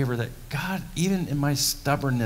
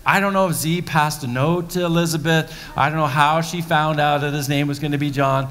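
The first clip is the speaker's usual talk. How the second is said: ordinary talk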